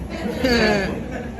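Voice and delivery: drawn-out, slow warning; slow mock threat. Dad repeating the threat to his son, slow. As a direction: stretch the threat out slowly. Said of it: "I said".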